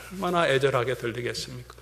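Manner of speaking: ordinary talk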